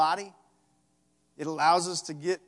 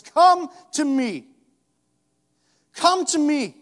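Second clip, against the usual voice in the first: slow, high, loud call; Jesus calling; 11 semitones higher, 13 dB louder, slower than usual. Acted. Jesus calling the listener to him, loud and slow. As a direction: call out with all your heart. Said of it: "He says".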